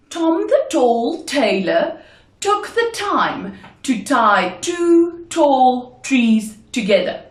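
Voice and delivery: slow, deliberate articulation with pauses; slow tongue twister. Shown as a demonstration. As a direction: spell it out slowly, word group by word group. Tongue twister, first slow take.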